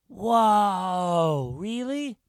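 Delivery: put-on high, drawn-out child's wonder; awestruck pupil. A narrator's reading. Pupil hearing of magician's glasses, awestruck. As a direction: marvel like a wide-open little kid.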